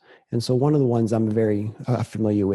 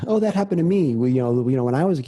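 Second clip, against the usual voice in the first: higher-pitched mock eager butting-in; eager interjection; 3 semitones higher, 4 dB louder, faster than usual. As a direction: jump in as if it's all about you. Acting out a self-relating listener.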